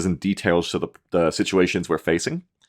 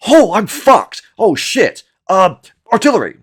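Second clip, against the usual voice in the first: high, loud panic, acted out; panicked outburst; 11 semitones higher, 7 dB louder than usual. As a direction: blurt it like you're suddenly overrun. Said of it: "HIMARS is me going".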